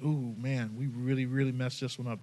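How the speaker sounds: like caught officials owning up; sheepish admission. Retold or imitated. Officials admitting their mistake.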